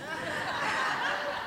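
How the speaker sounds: very high, quiet giggle; high soft laugh. Real, not acted. Preacher amused at her own story opening, high giggle. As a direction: let a small squeal of laughter escape.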